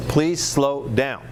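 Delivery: deliberately slow. Shown as a demonstration. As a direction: stretch each word out, very slowly. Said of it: "one of the things is slow down".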